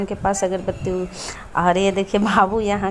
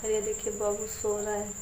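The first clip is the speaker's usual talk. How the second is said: speaking softly; 9 dB quieter than usual. Real, not acted. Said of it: "ज़ोर से बोलती हूँ तो उतना ही लगता है धीरे बोल रही हूँ".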